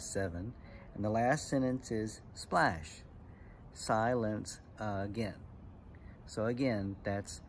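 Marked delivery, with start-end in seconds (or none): 2.3-5.3 slow, with a pause after the first word; measured haiku recital. Shown as a demonstration. Teacher reciting the last haiku line to kids, slow and measured. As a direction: let each syllable stand apart.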